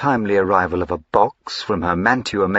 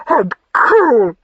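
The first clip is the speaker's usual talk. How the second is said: much higher and louder, indignant; wounded accusation; 14 semitones higher, 7 dB louder than usual. Acted. Wife accusing her husband, indignant wounded cry. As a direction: fling it back at him in hurt.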